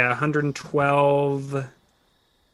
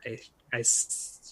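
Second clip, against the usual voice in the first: ordinary delivery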